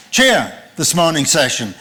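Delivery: ordinary delivery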